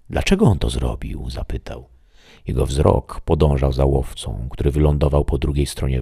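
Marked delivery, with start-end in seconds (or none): none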